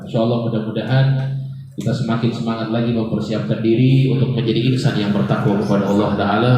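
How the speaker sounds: low, even-toned sermon benediction; steady low recital of hopes and supplications; closing prayer. Real, not acted.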